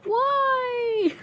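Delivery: high, soft, drawn-out whine; plaintive high wail; wailing lament. Real, not acted. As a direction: moan it long over a near miss. Lamenting a near miss.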